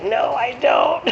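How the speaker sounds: high, slow, laughing no; a laughing, drawn-out no; laughing refusal. Retold or imitated. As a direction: imitate him refusing through a laugh. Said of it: "he was like".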